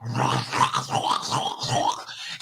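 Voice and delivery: wet, wordless munching noises; imitated zombie chewing. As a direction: mimic noisy chewing on juicy flesh. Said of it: "so it sounds like".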